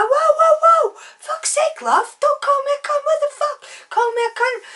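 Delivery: very high, loud mock outrage; upset man protesting. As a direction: imitate him squealing in protest. Acted man upset at insult.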